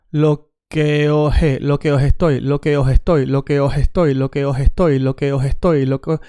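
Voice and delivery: "os estoy" with the S of os softened to an H; aspirated S accent. Shown as a demonstration. Teacher playing with different accents, S turned to H. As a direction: drill "os estoy" as "oh-hestoy", breath for S. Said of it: "What if we say like this?".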